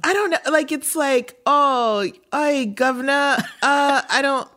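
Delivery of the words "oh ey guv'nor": higher-pitched attempt at old-timey British; old-timey British accent. Shown as a demonstration. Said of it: "Immediately, I go old-timey".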